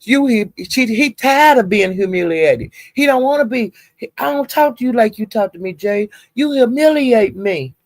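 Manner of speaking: mock whiny boyfriend voice; quoted hurt boyfriend. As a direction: play him wounded and pleading. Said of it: "he was saying".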